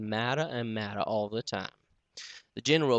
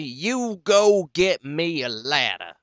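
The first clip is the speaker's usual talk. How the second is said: louder, slow commanding order; mad and bossy; 5 semitones higher, 8 dB louder, slower than usual. Acted. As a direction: bark it like a master to a servant. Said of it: "mad and bossy".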